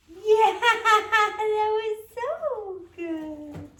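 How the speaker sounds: high, loud and very slow, laughing through it; over the moon, stretch it out; laughing praise. Real, not acted. Trainer gushing over the dog's success, laughing loudly. Delighted praise after dog holds.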